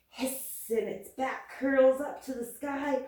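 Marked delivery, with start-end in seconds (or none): 0.1-0.6 soft voiceless hiss like an angry cat. Pretend. Teacher showing kids the mad cat, soft hiss. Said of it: "Cat gets mad and goes".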